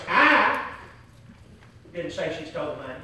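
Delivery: "I" stands out, then the sentence drops away; stress on I. Shown as a demonstration. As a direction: punch the word "I". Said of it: "Let me say it in different ways".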